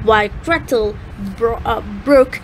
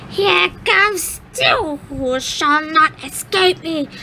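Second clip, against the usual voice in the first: high, slow gloating witch; wicked witch; 5 semitones higher than usual. Acted. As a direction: gloat over the children. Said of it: "she laughed wickedly".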